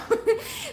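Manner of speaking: a short high laugh; giggle. Real, not acted. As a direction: laugh at mom. Laughing while telling the story.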